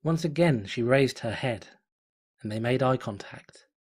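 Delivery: plain reading voice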